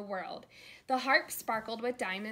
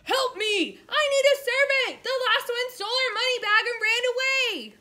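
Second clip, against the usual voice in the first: much higher, loud desperate plea; frantic giantess cry; 14 semitones higher, 14 dB louder than usual. A narrator's reading. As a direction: shriek for help like a giant woman. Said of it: "grabbed Kate and cried".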